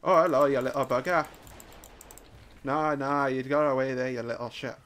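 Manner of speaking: put-on Irish brogue while playing; Irish accent. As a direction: play it as an Irish gamer muttering at prey. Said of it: "I couldn't hold that accent forever".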